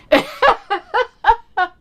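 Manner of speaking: high, loud cackling laugh; real laugh. Real, not acted. Host announcing a terrible accent, laughing. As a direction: burst out laughing at your own joke.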